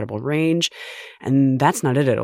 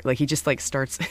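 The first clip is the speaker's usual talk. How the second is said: usual speaking voice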